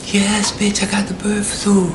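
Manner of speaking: high, breath-only whispered comeback; imitating a friend's indignant whisper; whispered retort.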